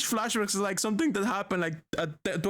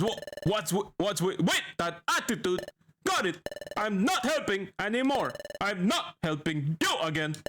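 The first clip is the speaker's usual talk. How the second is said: stammering, with "with", "not" and "you" jumping up; uncontrolled-volume quirk. Acted. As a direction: blurt "attitude", "not" and "you" in sudden yelps. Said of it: "some words come out at full volume".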